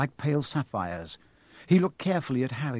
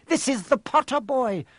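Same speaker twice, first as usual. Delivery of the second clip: higher urgent centaur voice; defiant centaur; 9 semitones higher than usual. A narrator's reading. Centaur insists the boy must leave, higher urgent voice. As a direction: insist on the boy's importance. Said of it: "said Firenze".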